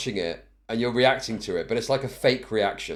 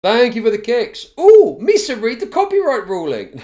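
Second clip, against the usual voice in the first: high mock voice; 10 semitones higher, 9 dB louder than usual. Reads donation in high voice.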